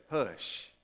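a soft, gentle hush to your own mind; kindest hush. Shown as a demonstration. Pastor showing the congregation how to quiet themselves, gently. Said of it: "in the kindest way you need to say it".

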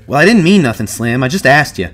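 rattled self-justifying protest; defensive. A narrator's reading. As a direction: back off and plead innocence. Curly defends himself to Slim.

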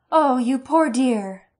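high, slow, loud cry of sympathy; cried. Acted. Elaine rushing up to comfort Katrina, high and loud. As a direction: gush over the poor girl. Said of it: "she cried".

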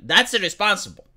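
ordinary talk

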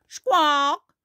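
high squawking parrot voice; Polly. Acted. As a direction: squawk loudly, then parrot the words back high. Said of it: "Polly opens her beak".

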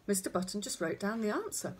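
plain reading voice

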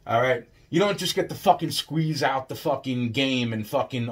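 ordinary delivery

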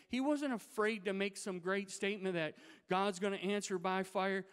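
building preaching. Acted. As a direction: preach it, building with every line. Rising preaching about Elijah.

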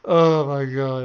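loud thrilled exclamation; excited outburst. Real, not acted. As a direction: blurt it out elated. Burst after the fight.

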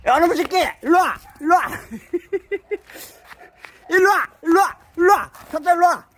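loud call to the dog. Real, not acted. Owner calling his dog loudly. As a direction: call the dog's name across the yard.

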